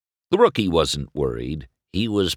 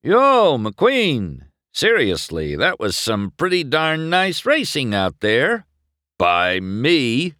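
higher, punchy ribbing; cocky rival; 8 semitones higher, 4 dB louder, slower than usual. A narrator's reading. Rival boasts to the racer, cocky. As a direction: set up and land a smug joke.

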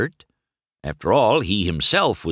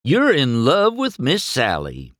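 teasing; 8 semitones higher, 4 dB louder than usual. A narrator's reading. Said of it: "Mater teased".

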